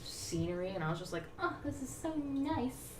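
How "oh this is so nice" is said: high, slow, soft-edged cooing; acted delight. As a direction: sigh it out in played-up bliss. Acting delight at the scenery.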